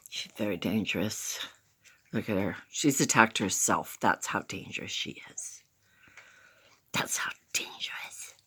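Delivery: hushed pretend dread; mock scared whisper. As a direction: whisper as if the puppy were a menace. Mock danger about puppy.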